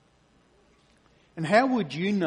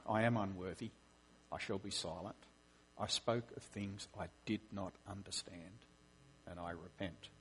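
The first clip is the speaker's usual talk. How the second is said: softer and softer, fading to near nothing; quiet repentant fade; humble admission; 5 semitones lower, 13 dB quieter than usual. Acted. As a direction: let the confession shrink away in humility. Job humbly admits he's wrong.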